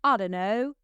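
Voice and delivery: mock Australian, rising at the end; tentative imitation of an Australian; tour guide's Australian accent. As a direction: try out an Aussie accent. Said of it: "the tour guide was like".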